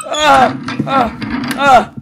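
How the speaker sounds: short, loud high yelps; frightened yelps. Real, not acted. Player still jumpy after the scare, frightened yelps. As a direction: yelp in bursts of fright.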